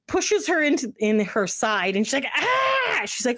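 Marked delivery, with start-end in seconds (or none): 2.3-3.0 high-pitched mock yelp of pain; sudden high "ah" of pain; retold pain yelp. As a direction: yelp as if poked in a sore side. Sister yelps when pushed in side.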